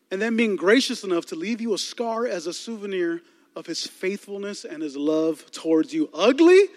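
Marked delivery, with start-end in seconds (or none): 6.1-6.7 higher, louder incredulous Ugly; indignant echo. Acted. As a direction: throw the word back, offended. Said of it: "indignant echo".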